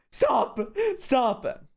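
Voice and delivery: high, crying plea from the victim; mock-pained yelp to make it end; acted begging cry. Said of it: "and he's like".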